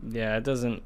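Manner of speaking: ordinary talk, nothing marked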